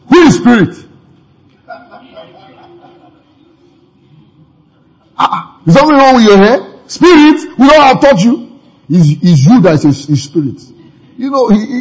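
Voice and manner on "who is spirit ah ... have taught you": raised, higher, louder scolding; mock indignant retort. Preacher imagines rebuking the frightened disciples, higher and louder. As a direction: scold them as if offended. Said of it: "I would have said".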